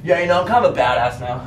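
loud laughing bravado; laughing off fear. Real, not acted. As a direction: laugh it off, playing tough. Joking bravado after scare.

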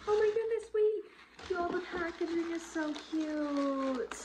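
very high, excited gushing; high-pitched delighted outburst; thrilled squeal. Real, not acted. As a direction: squeal over something adorable. Thrilled by the cute packaging.

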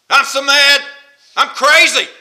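loud, high mock outburst; acted fury. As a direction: play someone flying off the handle. Acting out flying off the handle.

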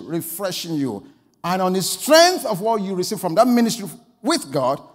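loud, driving preacher's delivery; forceful preaching. Real, not acted. Preacher urging ministers back to private prayer, forceful preaching. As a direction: preach it hard, hammering each point home.

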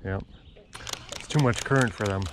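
ordinary talk, nothing marked

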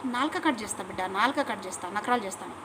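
quiet threat against the critics; low menacing threat. Real, not acted. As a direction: drop the voice to a threat.

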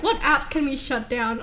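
ordinary talk

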